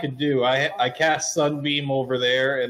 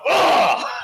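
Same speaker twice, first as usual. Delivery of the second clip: high, loud acted yelp; pained cry; 6 dB louder than usual. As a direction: cry out as if caught in a burst of light. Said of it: "you hear [name] go".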